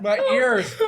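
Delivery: high crying laugh. Real, not acted. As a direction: wail through tears and laughter. Crying from hot wings.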